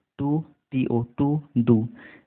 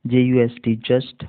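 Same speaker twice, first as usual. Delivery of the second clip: normal talking voice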